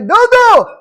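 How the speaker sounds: very high, loud holler; imitating a full-volume shout; screamed call. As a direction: yell the name across the street. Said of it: "screams out really loud, yells out".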